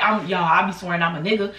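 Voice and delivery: normal talking voice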